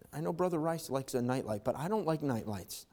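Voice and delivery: ordinary delivery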